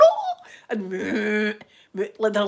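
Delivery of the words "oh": high laughing yelp; laughing squeal. Retold or imitated. Retold panic among cows.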